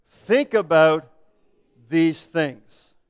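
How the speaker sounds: very slow and deliberate, pausing mid-phrase; slow scripture reading. Real, not acted. Pastor closing a verse read together, slow and deliberate. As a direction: draw it out for a group reading aloud. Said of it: "I'm gonna read it slowly".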